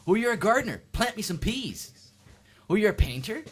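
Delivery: high, loud mock enthusiasm; put-on presumptuous acquaintance; pushy request. Guest making demands of a gardener, high loud mock-eager voice.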